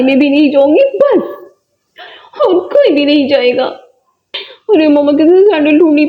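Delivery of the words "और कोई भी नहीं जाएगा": normal talking voice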